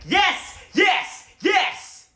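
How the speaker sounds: loud, high repeated yes; hyped yes chant; excited cheering. Retold or imitated. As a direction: cheer like the trailer just dropped. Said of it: "I was like".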